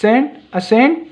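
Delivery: "सेंट", then "असेंट" with an added opening vowel; pronunciation demo. Shown as a demonstration. Teacher has pupils sound out the options, "सेंट, असेंट" pronounced apart. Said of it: "Maybe आप pronunciation करो बच्चों".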